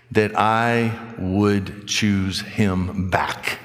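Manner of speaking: extremely slow, one word at a time; drawn-out, deliberate punchline to the point; weighty reveal. Real, not acted. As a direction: let each word land separately. Answer after "listen really closely.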